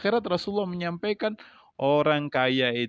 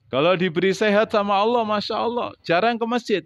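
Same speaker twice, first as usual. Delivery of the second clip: loud preaching; 7 semitones higher, 4 dB louder than usual. Acted. Preacher mocks healthy neglect.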